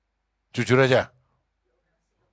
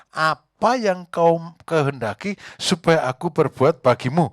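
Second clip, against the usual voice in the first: preaching verse; 5 semitones higher, 8 dB louder, slower than usual. Acted. Preacher repeats verse forcefully.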